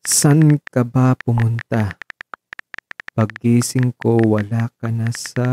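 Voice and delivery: dictating. Acted. Dictating text message slowly.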